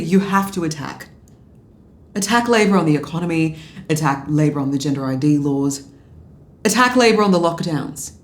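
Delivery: loud, pushing each "attack"; urgent insistence. Retold or imitated. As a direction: drive the point home, forceful. Retold urging Henry to attack.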